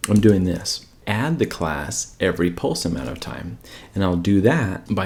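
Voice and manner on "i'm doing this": usual speaking voice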